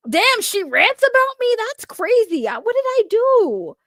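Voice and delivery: high, incredulous whine; hurt disbelief. Real, not acted. Told that someone's wife rants about them, wounded disbelief.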